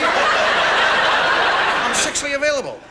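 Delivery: slow, loud, hammed-up come-on; mock seductive. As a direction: drawl it out like a cheesy seducer. Comic demo, pointing with foot.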